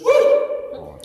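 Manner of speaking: very high-pitched "woo"; imitated excited whoop. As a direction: mimic a boy cheering. Son's excited cheer retold.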